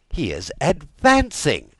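loud falling outburst on advancing; alarmed exclamation. Acted. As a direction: declare it in shocked alarm. King repeats news with alarm.

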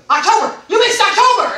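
loud, high, triumphant pounce; excited imitation. Rep finding caller's missed month, excited. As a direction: crow over a found mistake. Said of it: "she was so excited that she found it".

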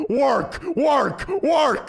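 punched orders climbing in pitch; commanding. Acted. Strict dad putting child to work, rising commanding chant. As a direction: drive each word out like a drill order.

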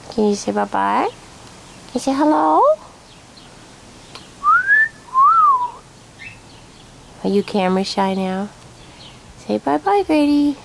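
high sing-song pet voice; teaching a parrot. Real, not acted. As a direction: coax the bird, high and sweet. Coaxing a parrot to talk.

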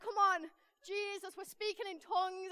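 hammed-up prayer-meeting shout; retold fervent prayer. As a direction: egg Jesus on, voice pushed up. Retold fervent group prayer over car.

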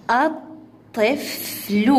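الطفل slowly as at, tif, lu; syllable by syllable. Shown as a demonstration. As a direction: split الطفل into three separate beats. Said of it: "تقطيعها".

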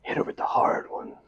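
soft unvoiced aside; whispering. Real, not acted. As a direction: whisper it conspiratorially. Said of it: "whispered aside".

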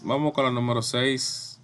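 usual speaking voice

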